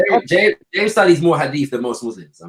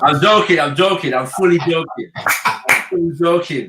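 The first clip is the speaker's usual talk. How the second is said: laughing; 6 semitones higher, slower than usual. Real, not acted. Laughing backtrack after joke.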